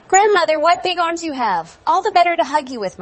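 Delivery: high, loud storybook exchange; amazed exclamation. A narrator's reading. Girl amazed at grandmother in bed, high loud exclaiming. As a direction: pitch it way up and project it.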